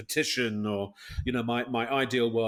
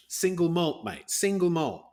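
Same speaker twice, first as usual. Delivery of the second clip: put-on Scottish accent. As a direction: slip into a Scottish accent for the whisky line. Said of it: "was that a Scottish accent".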